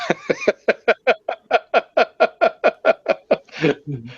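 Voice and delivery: high, long giggling fit; a real, helpless run of laughter. Real, not acted. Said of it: "I'm laughing".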